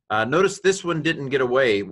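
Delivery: ordinary talk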